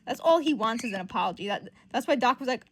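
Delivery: usual speaking voice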